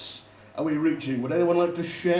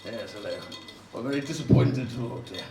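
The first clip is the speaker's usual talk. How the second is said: prim, lower-pitched socialite voice; society starlet voice; 8 semitones lower, 4 dB quieter than usual. Pretend. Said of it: "I sound like a society starlet".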